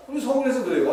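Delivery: usual speaking voice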